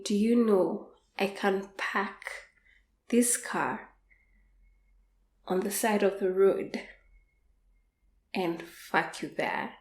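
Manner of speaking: hushed threat. Retold or imitated. Quoting lover's threat in car.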